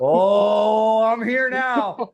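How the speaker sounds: loud, drawn-out mock roar into words; lioness roar demo. Shown as a demonstration. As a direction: stretch it out slowly like a lioness announcing herself. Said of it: "I want you to be like".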